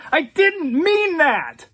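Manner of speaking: high, slow, loud howl; desperate dealer howling an apology. Acted. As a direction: wail it after them down the hall. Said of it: "Patrick howled".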